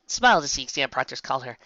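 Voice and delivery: ordinary delivery, nothing marked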